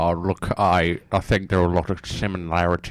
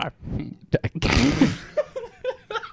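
high laugh; 3 dB quieter than usual. Real, not acted. Laughs at nickname question.

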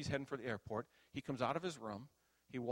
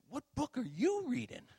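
loud, high-pitched incredulous challenge; retold rebuke; 13 semitones higher, 8 dB louder than usual. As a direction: challenge him loudly, like a mentor rebuking. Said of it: "He'd rebuke me".

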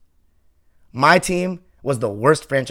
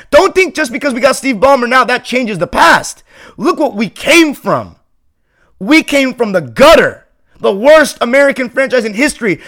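higher and louder, pounding out the grievances; heated fan rant; 7 semitones higher, 6 dB louder than usual. Real, not acted. Fan scolding doubters about the team's past, in a heated rant. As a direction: vent years of fan pain at the doubters.

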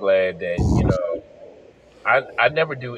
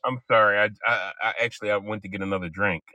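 normal talking voice

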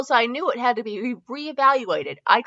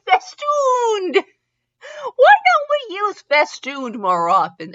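loud, high, stretched-out crowing; gleeful exclaiming; 12 semitones higher, 7 dB louder, slower than usual. Real, not acted. Reader championing a neglected word, gleefully loud. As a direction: cry out the word with glee.

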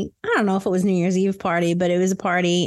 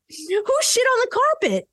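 laughing; 13 semitones higher than usual. Acted. Acted outburst while laughing.

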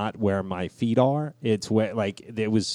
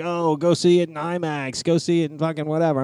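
mocking others; 7 semitones higher than usual. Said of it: "everybody's like".

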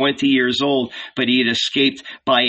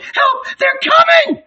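much higher, louder screaming in terror; panicked scream; 6 dB louder, slower than usual. A narrator's reading. Hunted woman warns everyone in the yard, screaming in panic. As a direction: scream that they are coming for her. Fleeing woman cries for help.

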